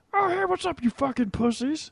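very high nasal nerd voice; squeaky nerd impression. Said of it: "never heard a nerd that was like".

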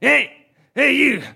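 high-pitched "hey you" shout; calling out. Acted. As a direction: holler after someone from across the lawn. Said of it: "I just hear this voice go".

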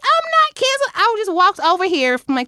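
retold outrage, voice shooting up high; indignant outburst. Refusing driver, indignant retold rant.

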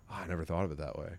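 quiet, low retold musing; softly imitating a man taking it in; hushed reflection. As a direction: murmur it, lost in thought. Retold moved reply, turned to hills.

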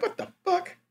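a high, stunned squeak of confusion; mock outrage at being tricked; baffled what the fuck. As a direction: pitch shoots way up in disbelief. Finds the door is the bathroom.

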